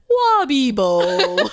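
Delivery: loud, high drawn-out Simlish cry; high mock-Sim exclamation; Simlish gibberish. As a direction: belt out silly Sim nonsense, stretched long. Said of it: "brought forth my Simlish".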